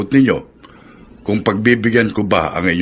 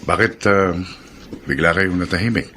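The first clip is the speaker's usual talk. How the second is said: ordinary talk, nothing marked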